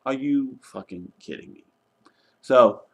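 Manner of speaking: normal talking voice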